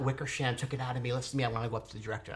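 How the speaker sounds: ordinary delivery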